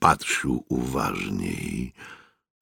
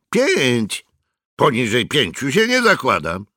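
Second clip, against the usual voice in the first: lightly voiced youth, pitched higher; a hint of a boy haggling; high boyish voice; 8 semitones higher than usual. A narrator's reading. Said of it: "Miał wysoki chłopięcy głos". (lekko chłopięcy, wyższy głos)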